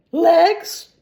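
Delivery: can't believe it; outraged echo. Acted. Milligan outraged at the author's answer.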